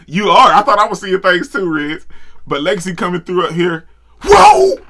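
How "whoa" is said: high whoop breaking into a breathy laugh; laughing shout. Real, not acted. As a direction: yell then crack up. Reacting to big jump.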